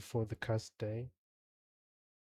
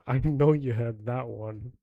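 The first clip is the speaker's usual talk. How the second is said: ordinary talk, nothing marked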